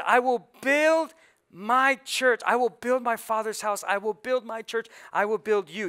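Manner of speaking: preaching the promise with force; emphatic preaching. Acted. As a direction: proclaim it as Jesus' promise. Preacher voicing Jesus, emphatic.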